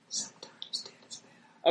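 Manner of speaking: eerie, whispered horror-movie line; soft unvoiced whisper, hushed and ominous; creepy whisper. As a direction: breathe it out like a ghost story.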